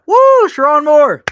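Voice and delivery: high, very loud whoop; mock cheer. Co-host cheering a coach in jest, loud high whoop. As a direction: shout it out like a rowdy fan.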